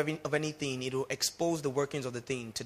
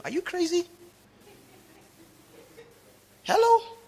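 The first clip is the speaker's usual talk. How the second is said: high shocked outburst, rising hello; mock a parent aghast at the idea; incredulous parent; 5 dB louder than usual. Parent scolding a teen, high shocked outcry.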